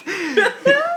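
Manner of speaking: high helpless laugh breaking out mid-reading; snorting laugh at the silly line; burst of real laughter. Real, not acted. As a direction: lose it completely, a real high giggle. Reader cracks up at own text.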